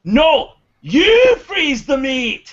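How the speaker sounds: very slow, high, loud holler; hammed-up yell. Durgan clanking armour in a staged fight, hammed-up yell. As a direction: bellow it drawn out, as a showy act. Acts Durgan yelling for show.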